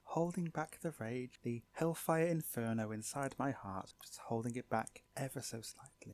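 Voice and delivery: mock rage held back in whispers; very soft, seething restraint; suppressed rage. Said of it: "on the verge of just exploding".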